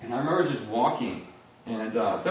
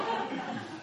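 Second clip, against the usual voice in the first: breathy laugh; 8 dB quieter than usual. Real, not acted. Laughing at his own story.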